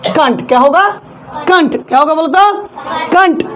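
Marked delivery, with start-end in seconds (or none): none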